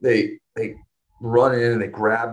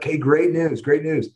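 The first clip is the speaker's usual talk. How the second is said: higher-pitched, eager imitation of a surgeon; retold good-news announcement; 7 semitones higher than usual. Surgeon bringing parents good news, imitated eager announcement. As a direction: announce it brightly, as a quoted surgeon.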